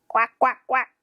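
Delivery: short, even quacks; lightly imitated duck; duck quacking. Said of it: "What the sound does duck make".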